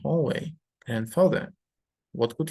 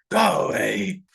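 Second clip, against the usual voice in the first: louder barked go away; sudden shout; 3 semitones lower, 5 dB louder, slower than usual. Acted. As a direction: snap at the child to leave. Grandfather shouts at child.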